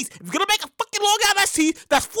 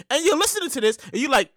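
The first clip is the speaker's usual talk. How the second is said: ordinary talk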